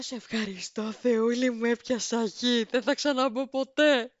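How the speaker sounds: mock-weepy, drawn-out vow; slow, put-on crying voice; imitated tearful thanks. Weeping teen thanking God after landing, acted tearful voice. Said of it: "τούμπανο από το κλάμα".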